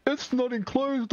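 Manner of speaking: high, slow, put-on voice for the game's message; game warning voice. Player mocks the game's warning in a high slow voice. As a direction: say it like a robotic game prompt. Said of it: "But will it be like".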